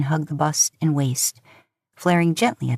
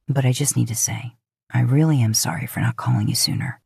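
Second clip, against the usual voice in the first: lower male love interest, apologetic; 5 semitones lower than usual. Acted. Man apologizing to her for not calling, lower sorry voice.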